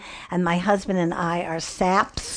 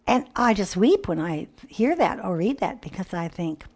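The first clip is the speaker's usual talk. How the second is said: ordinary delivery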